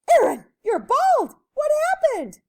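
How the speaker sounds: loud, very high shocked cry; high-pitched loud astonishment; shocked mother. A narrator's reading. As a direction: exclaim it like an alarmed storybook mom. Mother shocked at bald son.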